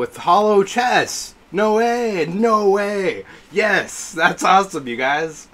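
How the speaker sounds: high, excited exclamations; thrilled. Real, not acted. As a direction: burst out in fan excitement. Thrilled reaction to game reveal.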